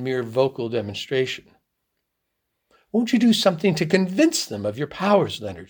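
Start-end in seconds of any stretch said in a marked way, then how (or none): 2.9-5.7 higher, earnest plea; pleading. A narrator's reading. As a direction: coax with an urgent appeal. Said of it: "she pleaded".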